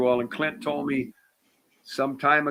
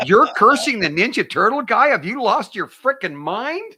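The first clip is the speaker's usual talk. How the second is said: higher, louder mock outrage; hammed-up indignation at the old friend; mock indignant; 9 semitones higher, 5 dB louder than usual.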